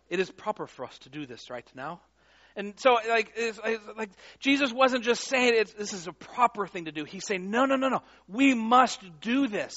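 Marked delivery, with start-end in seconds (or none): 0.1-2.0 a soft, light English accent. Shown as a demonstration. Preacher gently mocking a stuffy translation in an English accent. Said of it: "he should do this with an English accent". 7.5-9.7 urgent no no no, slowing on we must; passionate insistence. Retold or imitated. Jesus pressing John to baptize him, passionately insistent. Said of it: "There was passion in this".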